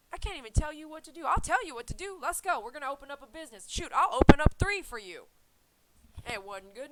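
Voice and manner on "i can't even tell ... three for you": mock eager helper.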